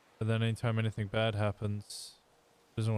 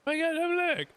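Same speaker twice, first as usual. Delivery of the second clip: mock pain yelp. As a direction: yelp as if the leg really hurt. Mock pain after being hit.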